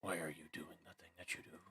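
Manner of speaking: very soft, whispered grumble; hushed, barely voiced aside; muttering to oneself. Real, not acted. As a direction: mutter it under your breath at misbehaving software. Muttering at stuttering stream.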